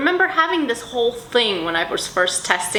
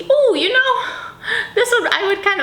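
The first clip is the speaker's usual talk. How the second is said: excited ooh; 3 dB louder than usual. Real, not acted. Excited gasp over a perfume.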